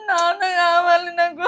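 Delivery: slow, loud sobbing plea. Acted. Wife wailing to her husband that she wants her father, slow sobbing. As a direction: cry it out like a lost child.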